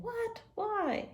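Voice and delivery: high, puzzled What? Why?; a sudden high-pitched protest from kids; surprised. Pretend. Kids told no Moses today, pretend surprised protest. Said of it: "you're probably like".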